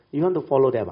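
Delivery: loud, high pointed challenge; challenging retort. Retold or imitated. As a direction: throw the question at her. Challenging mother, rhetorical.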